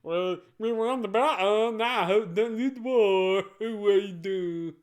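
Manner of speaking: derpy voice. Acted. Mocking Gino because he hates him, loud derpy voice. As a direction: make him sound dumb and derpy. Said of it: "give him a derpy voice".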